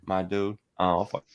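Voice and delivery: usual speaking voice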